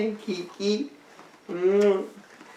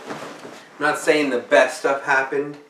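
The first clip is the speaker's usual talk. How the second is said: ordinary delivery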